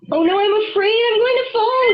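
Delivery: high, frightened cry from a treetop; afraid. Acted. Wolf atop the tree, afraid of falling. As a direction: cry out, scared to fall. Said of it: "he cried".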